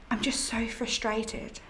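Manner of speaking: a soft, tired whisper. Acted. Ghost apologising to the medium, whispering. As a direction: let it fall to a breathy whisper.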